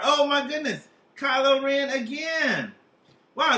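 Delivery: mock surprise. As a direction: marvel at the card. Reacting to drawn card.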